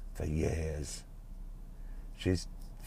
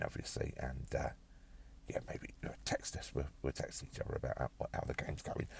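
whispering; 4 semitones lower, 11 dB quieter than usual. Acted. Secret plan, don't tell Carol.

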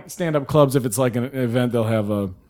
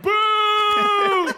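high, shouted mock boo; crowd boo. Audience booing the comic off stage, high shouted boo. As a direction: boo like the whole room.

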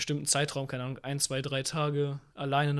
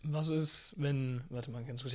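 something stuck in the throat; talking through a frog in throat; 8 dB quieter, much slower than usual. Real, not acted. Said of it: "ich hab irgendwas im Hals".

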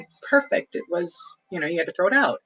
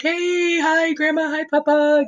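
put-on high, bright child's hello; excited kid greeting; 10 semitones higher, slower than usual. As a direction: greet grandparents like an eager little kid.

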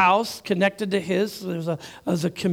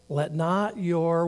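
slow prompting read; much slower than usual. Shown as a demonstration.

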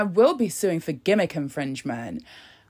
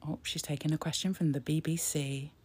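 hushed whisper over the broadcast; a soft unvoiced aside; 5 semitones lower, 10 dB quieter than usual. Real, not acted. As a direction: lean in and whisper the news. Whispers live commentary.

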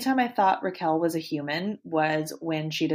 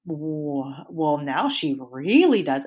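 usual speaking voice

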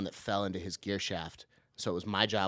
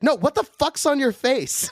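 higher, louder, slow stunned boss shout; boss yelling; 14 semitones higher, 10 dB louder than usual. Retold or imitated. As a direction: bellow it at the late worker. Angry boss yelling at him.

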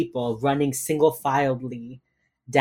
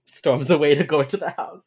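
ordinary delivery

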